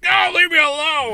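high mock shout; hammed-up yell to be left in peace; angry brush-off. Friend snapping back at her pleas, high mock shouting.

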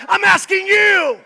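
high, loud cry of I'm asking you; a loud preacher's call; shouted plea. Acted. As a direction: hurl the plea at them. Peak shout of preaching run.

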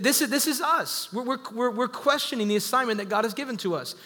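usual speaking voice

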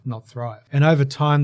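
normal talking voice

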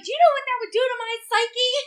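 high, fast, loud mock panic; dramatic protest. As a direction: rush it out in hammed-up alarm. Retold friend's dramatic protest.

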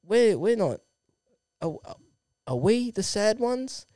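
soft, halting mock hurt; Gary, wounded. Pundit stung by the young player, soft halting mock hurt. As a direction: falter softly with long pauses. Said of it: "Gary says".

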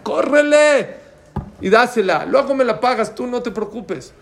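loud, high-pitched urging, easing at the end; urgent shout. Retold or imitated. Pharmacy owner sending mother to save her son, urgent shouting. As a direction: shout the order, then settle into reassurance.

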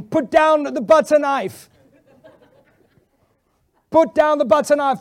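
loud, high, slow command; shouting. Retold or imitated. Pastor yelling at the girl to drop the knife, loud slow shout. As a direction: yell it to stop her, slowly. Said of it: "I was shouting".

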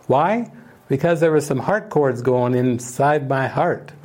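ordinary talk, nothing marked

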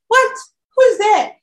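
high, loud startled cry. Retold or imitated. Student hears a voice in an empty dorm, startled cry. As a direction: jump at a voice behind you.

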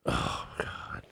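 whispered groan of dread; dismayed whisper. Real, not acted. Co-host hearing about Barbie movie, dismayed whisper. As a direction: let it out under the breath.

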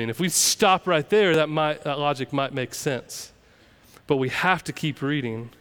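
normal talking voice

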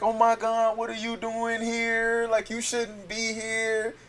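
high, slow mimicked woman; mimicking the woman. The chick in the nightgown at the car, high and slow. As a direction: put on her startled high voice. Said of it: "she like".

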